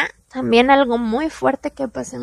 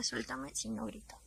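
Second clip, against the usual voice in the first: "Suéltame si no grito" said much softer, almost breathed; hushed threat; 21 dB quieter than usual. Retold or imitated. Held in a chokehold, warning him under her breath. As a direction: keep it down so the family downstairs won't hear. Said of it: "Su mamá y su hermana estaban abajo".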